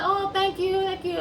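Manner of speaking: light, thrilled thanks to dad; delighted thanks. Retold or imitated. Daughter thanking her dad for birthday wishes, delighted. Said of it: "And I'm like".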